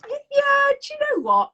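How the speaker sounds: sudden high, loud mock cheer; a burst of put-on brightness; mock cheery yeah. Mock cheery self-reassurance.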